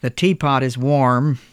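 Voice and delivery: put-on lightly gruff old man. A narrator's reading. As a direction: say it gruffly, curt. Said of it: "Mister Toomey said gruffly".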